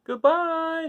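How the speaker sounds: the crowd shouting goodbye to the departing wizard; shouted. A narrator's reading. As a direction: call up to the balloon. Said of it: "shouted everyone".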